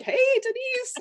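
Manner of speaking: very high, loopy "Hey, Denise"; drugged-happy father greeting sweetly; giddy medicated dad. Retold or imitated. Dad on medication greets his daughter, very high and loopy. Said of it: "my dad was in a real happy state".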